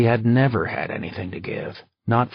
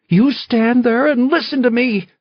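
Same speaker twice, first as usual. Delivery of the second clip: shrieking command, much higher and louder; screaming; 14 semitones higher, 8 dB louder than usual. Acted. Valerie stopping her husband at the door, screaming. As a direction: scream at him to stay and listen. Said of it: "Valerie screamed".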